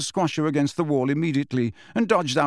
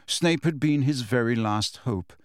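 usual speaking voice

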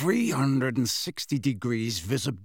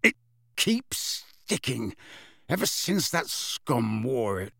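slow, irritated grumble; angry; 3 dB quieter, slower than usual. A narrator's reading. Wizard complaining about his stuck eye, angry. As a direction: grumble with slow, heavy annoyance. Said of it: "he added angrily".

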